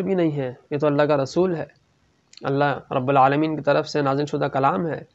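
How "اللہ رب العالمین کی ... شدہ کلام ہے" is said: ordinary talk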